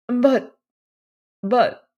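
a rising, flustered stammer. A narrator's reading. Mother stammering at news of a rich son-in-law, flustered. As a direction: stumble on the words in sudden agitation. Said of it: "Missus Frederick was visibly agitated".

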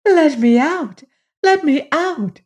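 high, urgent trapped voice; high-pitched plea from inside a tree. A narrator's reading. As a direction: call out pleadingly to be freed. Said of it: "a voice distinctly said".

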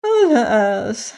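high, mock-tearful wail; hammed-up sorrowful cry; pretending to weep. A narrator's reading. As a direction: wail it as fake lament. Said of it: "pretending to weep".

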